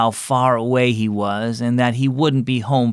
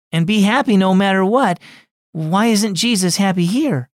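higher, lightly voiced little girl; a wistful child asking; Tiffany asking her mom about dad, higher child voice; young girl; 6 semitones higher than usual. Girl explains to aunt.

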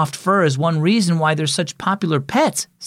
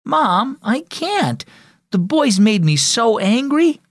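high-pitched exasperated protest; upset young girl; 8 semitones higher, slower than usual. Acted. Daughter to mother about her brothers, high upset protest. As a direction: plead it high, like a wronged kid.

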